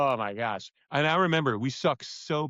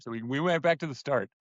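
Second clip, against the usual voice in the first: ordinary talk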